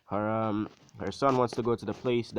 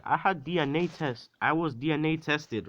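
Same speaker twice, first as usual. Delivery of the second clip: higher-pitched emphatic insistence; insistent son; 7 semitones higher, 3 dB louder than usual. Retold or imitated. Son convincing his doubting mother, acted insistence. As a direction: press the point, voice raised in pitch.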